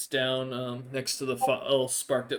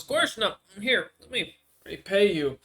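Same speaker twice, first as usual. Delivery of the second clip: NPC voice; 6 semitones higher, 4 dB louder than usual. Acted. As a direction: lift into the odd creature's voice, grateful but hungry.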